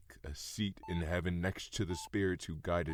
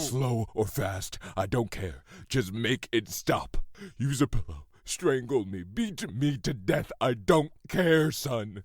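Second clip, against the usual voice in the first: higher and louder, desperate demands; frantic loud pleading; 6 semitones higher, 5 dB louder than usual. Acted. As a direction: shout out the begging. Father begs son to kill him.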